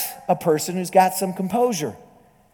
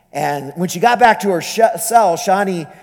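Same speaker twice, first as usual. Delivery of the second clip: ordinary talk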